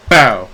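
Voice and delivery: loud, sudden "pow" with falling pitch; imitated impact noise. Acting out dodging a bear, imitated impact noise. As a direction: punch it out as a sound effect.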